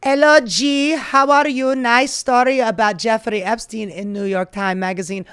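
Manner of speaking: loud, high, badly faked Slovenian accent; Melania. Writing to her dear friend Ghislaine, bad Slovenian accent. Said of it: "I do a shit Slovenian accent".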